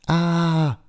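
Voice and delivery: high startled scream; boy screaming at the spider. A narrator's reading. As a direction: shriek in sudden fright. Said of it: "schreit Timon".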